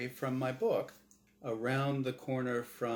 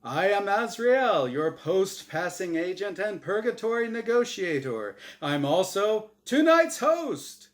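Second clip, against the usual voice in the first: game show host; 7 semitones higher, 8 dB louder than usual. Acted. Angel introduces himself on the show, booming host voice. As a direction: sell it like a TV host. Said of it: "his voice deep and glib like a radio announcer".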